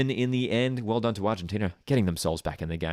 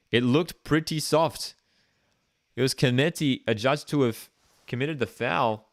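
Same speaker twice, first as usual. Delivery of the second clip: higher, brisk match call; play-by-play commentary; 7 semitones higher than usual. Acted. Calling a mock football match live, higher and animated.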